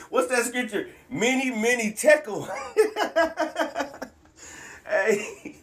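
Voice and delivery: real gleeful laughing. Real, not acted. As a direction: giggle it out. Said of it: "I'm sorry I'm laughing".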